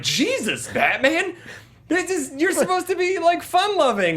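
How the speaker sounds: high pitched disbelieving cry; shocked outcry. Retold or imitated. Fan shocked at Batman's threats, high incredulous outcry. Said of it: "And you're like".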